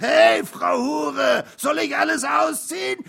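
very loud, high mocking shout; raucous drunken heckling; jeering yell. A narrator's reading. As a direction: scream the taunt, breathless with hilarity. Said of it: "schrie der andere und schnappte nach Luft".

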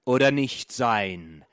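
loud, slow theatrical declamation; stage actor. Acted. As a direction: boom it out like Hamlet on stage. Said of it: "sagt der Schauspieler". (Schauspieler deklamiert laut und langsam auf der Bühne)

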